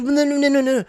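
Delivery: high-pitched whiny babble mimicking her nagging; exaggerated high nagging imitation; mock attitude. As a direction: mimic her complaint in a high singsong blur. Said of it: "like, giving an attitude".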